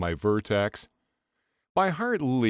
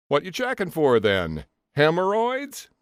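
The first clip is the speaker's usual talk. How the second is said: mocking tease; 8 semitones higher, 4 dB louder than usual. A narrator's reading. Phil teasing through door.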